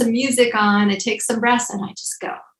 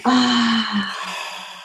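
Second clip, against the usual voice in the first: audible exhale sigh. Acted. Exhale during shoulder roll.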